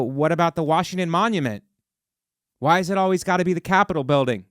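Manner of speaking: usual speaking voice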